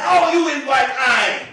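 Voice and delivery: loud Jamaican patois yell; a furious Jamaican dad complaining he wasn't invited; Jamaican dad shouting. Retold or imitated. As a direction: bark it at him like an angry Jamaican dad.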